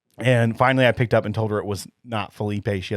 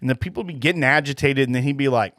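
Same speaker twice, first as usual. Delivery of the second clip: usual speaking voice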